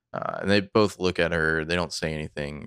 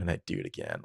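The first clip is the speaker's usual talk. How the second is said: lower, softer quoted line; quiet low callback; 11 semitones lower, 8 dB quieter, faster than usual. Retold or imitated. Quoting Spencer's con-game line, lower and softer. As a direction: murmur it low like Spencer did. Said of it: "a callback to Spencer from the con game".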